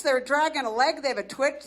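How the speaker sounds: ordinary talk, nothing marked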